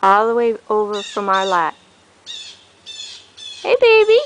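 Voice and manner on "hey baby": loud high call across the field. Shown as a demonstration. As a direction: call out to them from far away. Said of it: "I just called".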